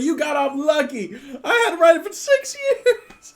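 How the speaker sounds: high, loud words through laughter; laughing hard. Acted. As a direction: shout it while acting helpless with laughter. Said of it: "laughing their faces off".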